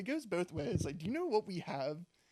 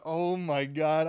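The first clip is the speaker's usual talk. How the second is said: loud, drawn-out mock disbelief; retold shock; 8 dB louder, much slower than usual. Son realizing the mystery texter is known, loud retold dismay. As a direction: stretch each word out loudly. Reaction to prank text reveal.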